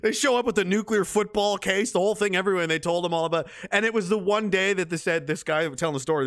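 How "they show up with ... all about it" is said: ordinary talk, nothing marked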